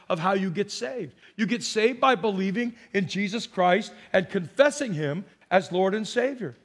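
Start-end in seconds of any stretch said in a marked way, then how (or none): none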